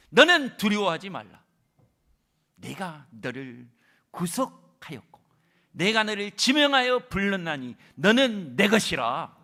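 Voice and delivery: loud, slow, weighty declaration; God's reassuring proclamation. Acted. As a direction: declare it over them slowly. Quoting God to the fearful. (힘있게 선포하는 하나님의 음성)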